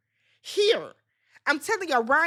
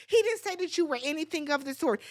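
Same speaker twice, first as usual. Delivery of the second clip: normal talking voice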